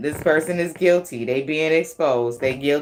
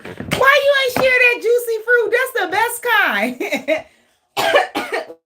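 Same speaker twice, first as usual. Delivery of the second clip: high, loud whining voice; mock whiny demand; 3 dB louder than usual. Asking why the gum wasn't shared, high whiny voice. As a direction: whine like a kid denied candy.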